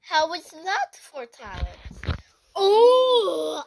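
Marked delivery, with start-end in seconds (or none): 2.6-3.6 high loud gasp of wonder; be dazzled by the trick; amazed ooh. Pretend. Judge reacts to the perfect mimic, amazed.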